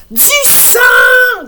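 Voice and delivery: very high, loud cry; shouting. Acted. Laurie waving the bloody finger, high loud shout. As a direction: yell it to stop the police. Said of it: "crie Laurie".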